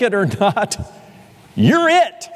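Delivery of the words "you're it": much higher and louder, flung out with force; triumphant preacher's cry; climactic declaration. Acted. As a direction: drive the point home with a burst.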